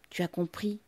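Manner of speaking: ordinary delivery, nothing marked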